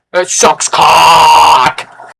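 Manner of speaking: loud, long-held last word; over-the-top drawn-out rant ending; hammed-up yell. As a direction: bellow and stretch out the final word. Climax of the mock rant.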